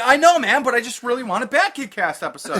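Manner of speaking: high, loud mock begging; pleading fan. Host quotes a fan wanting an episode, high mock pleading. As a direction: imitate an insistent fan, voice pushed up.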